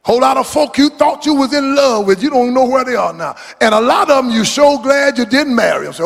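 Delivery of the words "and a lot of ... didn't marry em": ordinary talk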